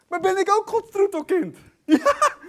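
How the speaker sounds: high, loud, laughing exultation; jubilant shout. Real, not acted. Preacher realising he is God's darling too, jubilant shout. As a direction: burst out in joyful amazement.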